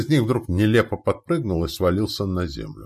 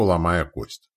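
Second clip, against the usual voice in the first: ordinary delivery, nothing marked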